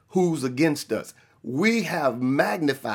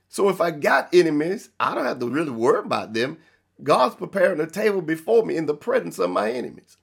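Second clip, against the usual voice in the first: ordinary talk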